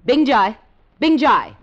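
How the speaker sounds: loud call across the hotel; loud summons for the guard. Acted. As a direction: shout the name so the guard comes. Calling the guard loudly.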